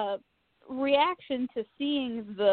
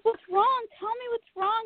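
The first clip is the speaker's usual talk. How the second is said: much higher, pleading and urgent; frantic worry; 10 semitones higher than usual. Retold or imitated. Daughter gripping her crying mom, high urgent pleading. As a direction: demand an answer out of fear. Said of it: "I'm just like".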